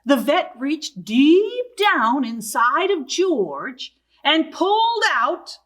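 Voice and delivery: usual speaking voice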